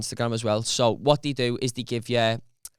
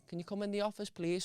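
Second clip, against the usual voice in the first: soft, higher-pitched polite request; quiet summons; 7 semitones higher, 11 dB quieter than usual. Retold or imitated. Boss calling worker into the office, quiet summons. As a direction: ask it softly and discreetly.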